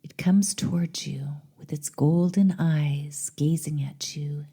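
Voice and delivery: measured meditation voice with long pauses; guided meditation. Acted. Host leading listeners on a journey to the goddess, meditative. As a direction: guide the listener inward, lingering between phrases.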